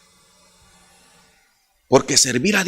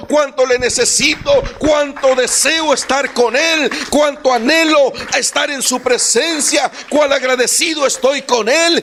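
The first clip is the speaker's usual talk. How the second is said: fervent preaching; 10 semitones higher than usual. Acted. Pastor urges the congregation, preaching with rising fervor. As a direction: pour out longing for God in full voice.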